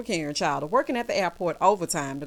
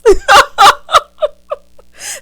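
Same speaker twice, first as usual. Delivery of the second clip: high-pitched laughing burst; real loud cackle; 16 dB louder than usual. Real, not acted. Cracking up at own singing, loud cackle.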